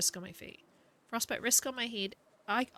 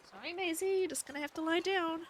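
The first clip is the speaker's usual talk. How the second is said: pet-talk to dog; 8 semitones higher than usual. Real, not acted. Apologising to her dog Maisie.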